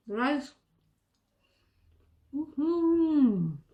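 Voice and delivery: talking through a mouthful; chewing while speaking; mouth full. Real, not acted. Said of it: "Sorry if my mouth is full".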